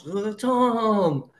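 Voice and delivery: high, hammed-up welcome; mock bullhorn cheer. The meeting answers Tom again, high mock cheer. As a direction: call his name like a crowd on a megaphone. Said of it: "the bullhorns".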